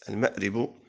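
المأرب said with "i" after the ra, ma'ribu; kasra on the ra. Shown as a demonstration. Teacher naming the variants of the fourth word, kasra in المأرب. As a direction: sound المأرب as ma'-RIB. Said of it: "جاء بالكسر".

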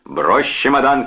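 mild shout to stop him; shouted order. A narrator's reading. Brother stops the departing man, shouting. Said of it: "крикнул Чарльз".

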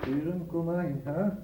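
slow, measured dictation with pauses, speaking the punctuation; formal letter dictated to a typist; dictating a letter. Acted. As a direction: spell out every comma and full stop as you dictate.

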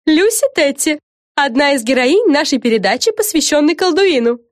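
high, lively TV presenter voice; showy reporter Fren. Acted. Fren opens an interview for her show, presenter-like. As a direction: present it brightly to the camera.

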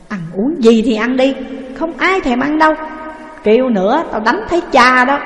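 husband snaps at wife, loud and angry; snapping husband. Acted. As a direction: bark at the wife to go away. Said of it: "thượng tứ nạt rằng".

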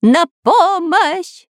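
high, stretched cry for help; panicked scream. A narrator's reading. Spy Dyrka begs rescue from the falling ship, high scream. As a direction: wail for rescue at high pitch. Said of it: "закричал шпион Дырка".

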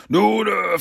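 verlegen, mit hoher Stimme; sich verteidigend. A narrator's reading. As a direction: kleinlaut zur Ausrede ansetzen. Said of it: "versuchte der Shredder sich zu verteidigen". (hohes, zögerndes Stammeln)

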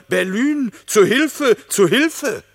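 high, loud cry for help; retold cry for help. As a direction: call out as Lampe panicking. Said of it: "Er rief mir". (lauter, hoher Hilferuf in Panik)

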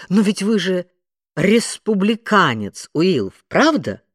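high, loud triumphant declaration. A narrator's reading. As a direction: pounce on the answer triumphantly. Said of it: "торжествующе заявила Мэри Джейн".

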